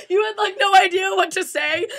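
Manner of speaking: ordinary delivery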